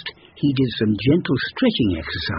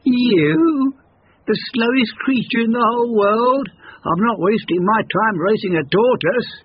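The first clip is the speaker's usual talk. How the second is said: mocking hare voice; 8 semitones higher than usual. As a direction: sneer at the tortoise. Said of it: "The hare laughed".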